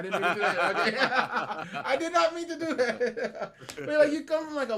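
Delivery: high, loud laughing apology; giggling through words after a slip; real laughter breaking through. Real, not acted.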